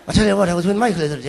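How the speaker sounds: ordinary delivery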